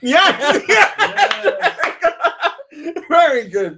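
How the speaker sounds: high laughing yes; excited. Real, not acted. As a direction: cheer them on, laughing. Excited praise for guess.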